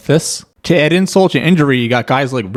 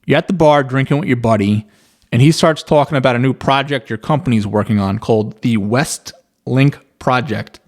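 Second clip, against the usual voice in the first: ordinary delivery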